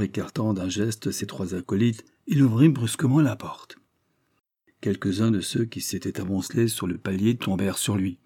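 usual speaking voice

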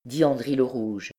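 usual speaking voice

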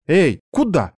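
high, outraged protest; indignant. Acted. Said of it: "возмутилась я".

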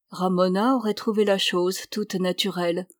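usual speaking voice

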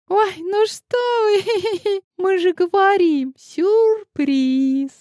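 put-on high, laughing, bubbly girl voice; giggly young girl. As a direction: giggle it out, drawn out. Young girls cheerfully insisting.

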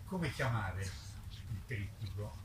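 normal talking voice

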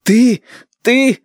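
high, loud, choked-off outburst; acted enraged grandson's shout; furious sputter. A narrator's reading. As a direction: sputter loudly, lost for words. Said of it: "от неожиданного крика".

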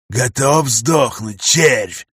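slow menacing growl; growling monster. Acted. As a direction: snarl it like a mutant boss. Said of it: "прорычал он".

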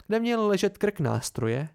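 plain reading voice

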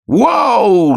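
very high, loud squeezed howl; long high-pitched strangled cry; strangled yell. A narrator's reading. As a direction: scream it out as if hit. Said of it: "сдавленно заорал Пашка".

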